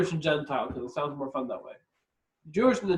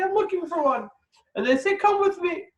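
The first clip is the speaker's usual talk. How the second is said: high eager voice; 12 semitones higher than usual. Retold or imitated. Acting out synagogue invitation.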